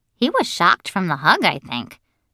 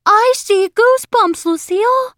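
very high, loud squeal; high-pitched cry of discovery; excited boy's shout; 6 dB louder than usual. A narrator's reading. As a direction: yell it out like an overexcited kid. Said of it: "shouted Sheldon".

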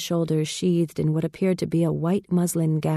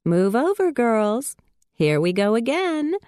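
light and higher, teasing; mischievous; 6 semitones higher than usual. A narrator's reading. Lovey to the sleeping girls, lightly mischievous. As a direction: tease the other girls along. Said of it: "with a hint of mischief".

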